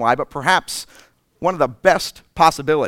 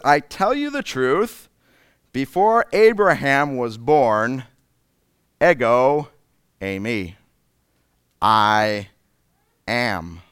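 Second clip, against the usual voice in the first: solemn proclamation; slower than usual. Acted. Solemn quote of Jesus.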